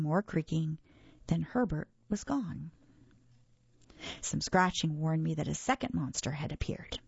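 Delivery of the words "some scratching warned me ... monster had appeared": ordinary delivery, nothing marked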